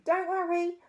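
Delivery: breezy cheerful reassurance; cheerful boy. A narrator's reading.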